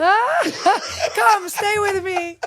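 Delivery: high, laughing welcome; playful George. Retold or imitated. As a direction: coax him to sit, teasing. Voicing George's invitation, laughing.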